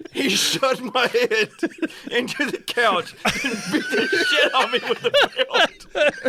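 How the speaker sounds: laughing disbelief. Real, not acted. Shocked laughter at the story.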